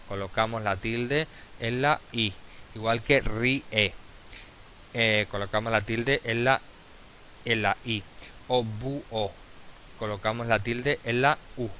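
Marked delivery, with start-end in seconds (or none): 3.2-3.9 "ríe" drawn out as rí-e; slow "ríe", split after a stressed rí; syllable by syllable. Shown as a demonstration. Teacher showing a hiatus, "ríe" in two separate syllables. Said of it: "hiatos formados por vocal cerrada". 8.6-9.3 "búho" separated as bú-o; syllable by syllable. Shown as a demonstration. Teacher showing a hiatus, "búho" in two separate syllables. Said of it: "hiatos formados por vocal cerrada".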